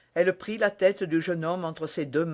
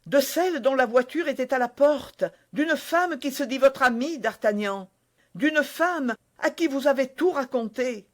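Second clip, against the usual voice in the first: put-on higher, innocent young woman explaining; lightly voiced young wife, earnest; young woman's voice; 7 semitones higher, 3 dB louder than usual. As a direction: naive Constance, a touch eager. Constance voiced by narrator.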